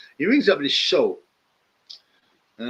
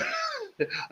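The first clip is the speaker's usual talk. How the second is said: high giggle; 9 dB quieter than usual. Real, not acted. Laughing reading a comment.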